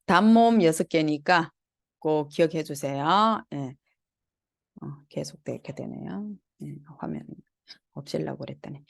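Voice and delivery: normal talking voice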